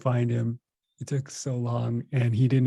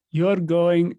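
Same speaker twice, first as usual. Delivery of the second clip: put-on Indian accent; 6 semitones higher, 6 dB louder than usual. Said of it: "I'll do my best Indian accent".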